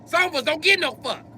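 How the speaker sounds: very high, over-excited cartoon voice; Cyborg.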